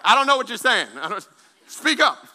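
loud, high, laughing demand; hammed-up loud husband, laughing; mock loud complaint. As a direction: yell it at a wife who only mouths words. Husband loudly asking wife to speak.

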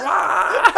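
put-on high, loud boy-band squeal; mock teen scream. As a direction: shriek like overexcited fourteen-year-olds. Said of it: "they'd be like".

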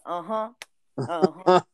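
a light [name]-style uh-huh. Retold or imitated. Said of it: "in a [name] voice".

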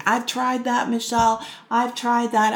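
fed-up client snapping they've tried it all; exasperated client. Retold or imitated. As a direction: throw it back at the coach in frustration. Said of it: "what I hear from them is".

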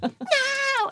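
loud, high mock tantrum yell; hammed-up squeal of protest; mock angry. Being picked up by a big friend, mock angry yell. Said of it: "I remember being so angry".

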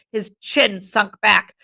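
ordinary delivery

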